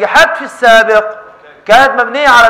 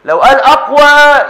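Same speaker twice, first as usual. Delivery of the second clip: كلمة "أقوى" أعلى وأبطأ بوضوح; اضغط على "أقوى" ببطء وبصوت أعلى; نبرة على أقوى; 4 semitones higher, 5 dB louder, slower than usual. Shown as a demonstration. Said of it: "ما يقولش بقوة". (شدد على "أقوى" بصوت أعلى وأبطأ)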